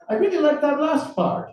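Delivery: put-on high, upbeat Russian-accented voice. Interrogator praising the article to its writer, upbeat. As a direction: sound tickled, pitched well up. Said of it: "his tone upbeat".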